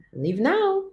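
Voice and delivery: normal talking voice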